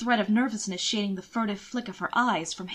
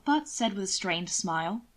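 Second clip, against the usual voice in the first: ordinary delivery, nothing marked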